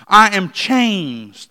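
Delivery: I am changed, each word slow and weighted; emphatic. Shown as a demonstration. Preacher stressing the healed man's claim, slow and emphatic. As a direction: lean hard and slow on I am changed. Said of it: "he wanted to emphasize".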